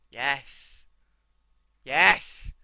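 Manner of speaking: drawn-out, building yes, ending in a loud cry; ecstatic. Real, not acted. Gamer delighted with his gear, ecstatic. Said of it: "sounds like I'm having an orgasm".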